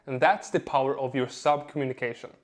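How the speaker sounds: usual speaking voice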